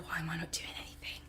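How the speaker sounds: breathy fast whisper of inner panic; hushed anxious inner voice. Retold or imitated. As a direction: whisper it quickly to yourself. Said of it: "because it's like".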